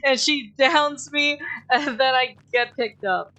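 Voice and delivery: loud, high, giddy exclaiming; exhilarated disbelief. Real, not acted. Player reliving a narrow escape, exhilarated.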